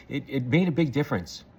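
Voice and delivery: normal talking voice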